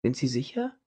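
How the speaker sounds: soft, calm, curious question; quiet, puzzled calm; almost polite. A narrator's reading. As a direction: ask gently, the rage gone. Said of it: "fragte sie beinahe höflich".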